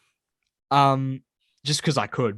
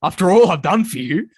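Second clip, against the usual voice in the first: louder, higher, hammed-up reproach; imagined wronged villain; 4 semitones higher, 6 dB louder than usual. Mate confronts him over that night, theatrically wounded. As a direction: play the betrayed mastermind, mock-dramatic. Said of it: "this is how I picture it".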